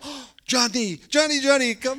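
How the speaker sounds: high, loud, delighted calling of Johnny; overjoyed aunt calling him in; excited welcome. Retold or imitated. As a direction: greet the long-lost relative with joy. Hungarian relatives welcome him warmly.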